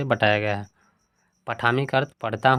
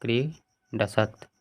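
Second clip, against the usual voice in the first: slow dictation; 4 dB quieter, much slower than usual. Shown as a demonstration. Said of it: "यहाँ पे हो जाएगा".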